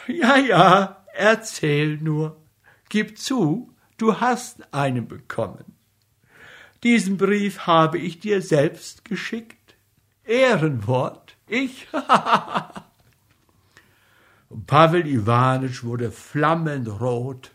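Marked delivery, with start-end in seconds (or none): 0.1-11.9 a wife gleefully teasing her caught husband; playful teasing. Acted. Wife teasing husband about letter. 11.9-12.8 high mocking laugh; wife's teasing high laugh; gleeful laugh. Wife laughs at her prank.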